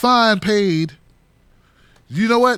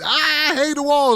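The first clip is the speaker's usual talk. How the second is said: mocking whiny protester; 9 semitones higher, slower than usual. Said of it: "people just".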